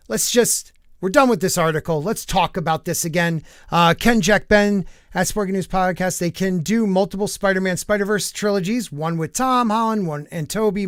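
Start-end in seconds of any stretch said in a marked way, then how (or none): none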